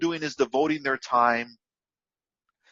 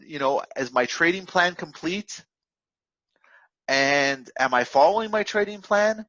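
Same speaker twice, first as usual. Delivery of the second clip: ordinary delivery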